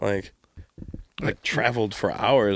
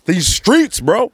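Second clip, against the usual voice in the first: loud, high joking boast; mock bravado; 9 semitones higher, 7 dB louder, much slower than usual. Joking that his platform is the streets, loud mock bravado. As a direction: burst out with pretend street swagger.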